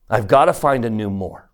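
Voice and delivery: normal talking voice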